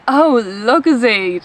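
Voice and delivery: LUCOZADE drawn out as "LOO-ko-zade"; slow mock realisation, Lucozade with z and silent e; imitating English speakers. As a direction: stretch Lucozade the English way, as if finally understanding. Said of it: "And they were like".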